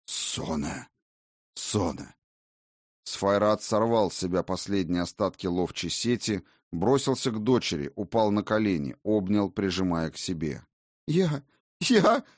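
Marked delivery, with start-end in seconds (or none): 0.1-2.1 father's low voice. Acted. Father calls to his daughter. 11.1-12.2 put-on high sobbing girl's cry. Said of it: "Всхлипывания обернулись бурными рыданиями".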